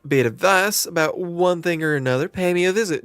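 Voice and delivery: lightly drawled southern landlady; a hint of a folksy southern innkeeper welcoming a newcomer; friendly southern twang, lightly done; southern accent. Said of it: "she went southern".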